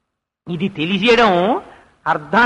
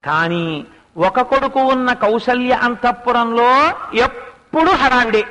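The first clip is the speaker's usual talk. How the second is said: usual speaking voice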